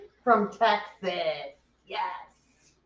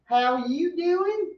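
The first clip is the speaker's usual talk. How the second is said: put-on New York accent; 6 semitones higher than usual. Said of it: "That sounded New Yorkie".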